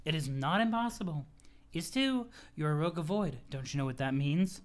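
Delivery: a hint of condescending mentor; pompous Jade. Jade lecturing Roxy on her powers, lightly pompous. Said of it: "pompous voice".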